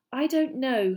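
mildly doubtful, pitch lifted; lightly voiced hesitation; hesitant, slow. A narrator's reading. Hermione doubts a night visit, hesitant. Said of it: "Hermione said slowly".

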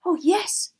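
high, loud elf squeak; squeaky vehement house-elf; furious agreement. A narrator's reading. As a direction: agree emphatically as a tiny elf. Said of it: "nodding her head furiously".

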